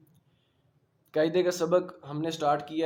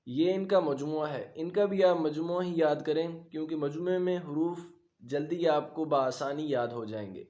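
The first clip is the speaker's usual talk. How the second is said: ordinary talk, nothing marked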